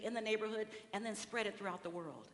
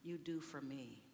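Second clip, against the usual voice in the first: solemn quote; 5 semitones lower, 5 dB quieter than usual. Acted. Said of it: "So Jesus said".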